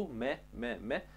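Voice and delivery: soft "me" with no pressure on the lips; soft, low, unpressed "me"; simple-touch M. Shown as a demonstration. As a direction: let the lips just touch for "me". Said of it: "se atinge simplu".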